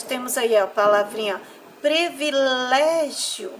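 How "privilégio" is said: "privilégio" slow, with "lé" drawn out; stress on penultimate syllable. Shown as a demonstration. Teacher showing children a paroxytone, slow stressed syllable. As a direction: slowly lean on "lé" in "privilégio". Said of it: "Na penúltima sílaba".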